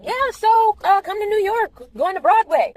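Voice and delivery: high, bright imitation of the casting people; mock eager producers.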